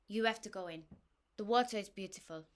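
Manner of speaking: put-on light, cute French accent, a bit abrupt; French accent, abrupt yet endearing. A narrator's reading. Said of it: "in an annoyingly cute French accent".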